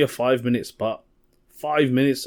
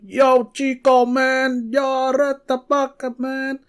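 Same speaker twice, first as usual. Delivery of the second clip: mock Mexican accent, much higher, loud and slow; hammed-up Mexican accent pitched way up; 7 dB louder, much slower than usual. Comedian imitating a Mexican accent to comics at the gig. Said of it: "I started doing all this Mexican accent".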